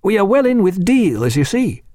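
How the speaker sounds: lightly voiced keen young officer. A narrator's reading. Reed voiced in narrated dialogue.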